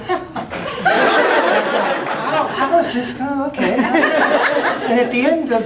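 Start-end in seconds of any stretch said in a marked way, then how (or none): 0.1-2.0 slow, airy, high mock laugh; imitated laugh. Retelling a colleague's skeptical reaction, slow breathy high laugh. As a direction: stretch out a breathy doubting ha-ha. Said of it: "then she'd go".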